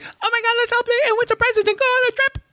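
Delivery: put-on very high, fast, squealing ad-girl voice; ditzy commercial girl. Mocking the dreadlock girl's commercial line, squealing high and fast. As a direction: gush it out like a giddy ad actress.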